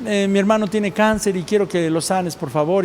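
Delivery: soft, casual mock petition; half-hearted prayer. Believer asking God to heal a brother, soft half-hearted plea. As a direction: toss off a prayer quietly, with no urgency. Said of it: "A veces nosotros nada más le pedimos".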